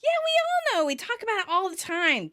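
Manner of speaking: high hammed-up friend voice; falsetto Phoebe. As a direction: toss it off in a high voice. Playing Phoebe in the recap.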